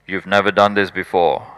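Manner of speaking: a nagging inner critic; mock inner doubter. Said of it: "my head was saying".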